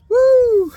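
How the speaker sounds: loud, very high woo of speed; high whoosh cry. Retold or imitated. Mother speeding back to the park, loud high woo. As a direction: sing out a rushing woo. Driving really fast back.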